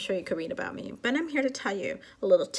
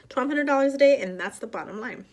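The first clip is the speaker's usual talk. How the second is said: raised and loud, dropping to a firm close; assertive; 4 semitones higher, 5 dB louder than usual. Shown as a demonstration. Repeating the quota to God as an example, assertive. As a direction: lay it down hard, then settle it. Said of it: "I say it like that".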